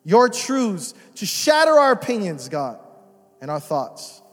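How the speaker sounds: hushed, reverent prayer voice. Acted. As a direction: pray it low and earnest over the room. Leads a prayer.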